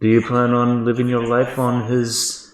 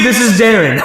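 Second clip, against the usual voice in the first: laughing high voice; 9 semitones higher, 7 dB louder than usual. Acted.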